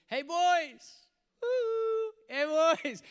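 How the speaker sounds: loud, high shout across the water; calling out. Acted. Jesus hails the fishermen from the shore, calling out. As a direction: holler to them with a long whoop.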